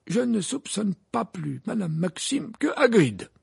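high, slow, composed voice; peaceful Dumbledore. A narrator's reading. Dumbledore defending Madame Maxime, peaceful. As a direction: answer slowly, untroubled, high in pitch. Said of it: "du même ton paisible".

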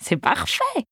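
delighted. A narrator's reading. Rosa approves her own pseudonym, delighted. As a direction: lift the voice high in delight. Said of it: "son visage s'illumine".

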